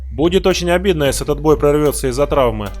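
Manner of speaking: normal talking voice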